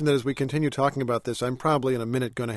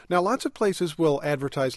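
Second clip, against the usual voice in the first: ordinary delivery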